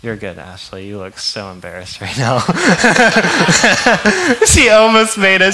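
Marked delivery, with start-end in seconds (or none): 2.5-5.5 high and loud through laughter; laughing. Real, not acted. Pastor laughing at embarrassed Ashley in the crowd. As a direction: tease her while cracking up.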